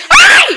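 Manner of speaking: sudden high-pitched ouch; yelp of pain. Real, not acted.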